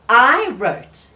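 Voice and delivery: proud reveal. Acted.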